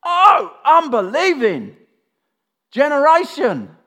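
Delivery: loud, high exasperated cry, drawn out; hammed-up frustrated shout; frustrated outburst. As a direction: thunder it out in exasperation. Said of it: "He said this. ... Can you hear the frustration?".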